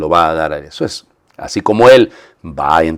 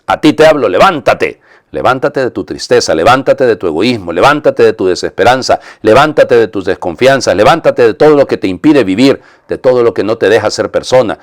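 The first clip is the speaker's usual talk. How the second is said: raised, insistent preaching command; forceful, higher, rhythmic exhortation; exhorting; 4 semitones higher, 4 dB louder than usual. Acted. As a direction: urge them to rise, phrase after phrase. Prayer voicing Jesus's command to rise.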